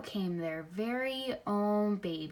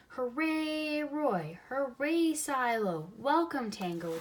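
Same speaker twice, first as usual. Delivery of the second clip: cheering crowd; 5 semitones higher, slower than usual. A narrator's reading. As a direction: cheer the penguins like excited zoo children. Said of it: "they cheered".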